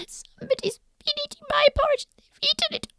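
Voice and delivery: put-on very high fast squeaky baby bear; very high rushing baby voice. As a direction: squeak it like a tiny cub. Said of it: "said Baby Bear".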